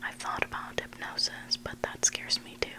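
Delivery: ordinary delivery, nothing marked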